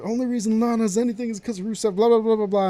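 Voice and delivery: high, heated mock rant at a stagehand; imitating a bitter wrestler ranting.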